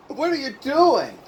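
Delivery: high, slow, incredulous question; retold protest at wasted food; mock dismay. Acting out dismay at wasted pasta.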